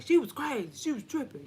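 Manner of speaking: high, mocking husband voice; teasing husband impression. Wife mimics husband saying she was crazy, high and mocking. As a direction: mimic him calling her crazy. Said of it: "He'll be like".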